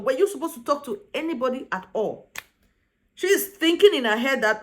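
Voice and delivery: ordinary talk, nothing marked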